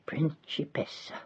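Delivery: barely audible whisper of Principessa; whispered to the girl she once served; spoken very softly. A narrator's reading. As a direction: breathe the word Principessa almost soundlessly. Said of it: "a word spoken so softly".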